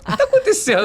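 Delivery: high surprised wife's voice. Retold or imitated. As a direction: squeak it out, startled. Wife coming out of room.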